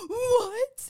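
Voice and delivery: gasp, then a high, loud incredulous question; shocked gasp. Retold or imitated. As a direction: re-enact hearing astonishing news. Acts out shock at sister-in-law's news.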